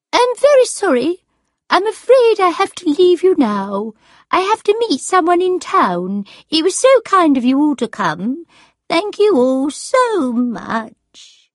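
very high, loud, gracious hostess voice; grand farewell. A narrator's reading. Sarah bidding her audience goodbye, grandly. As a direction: take your leave like a star, drawing out the thanks.

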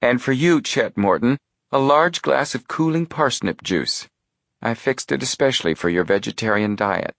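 a hint of a doting aunt; fussing aunt. A narrator's reading.

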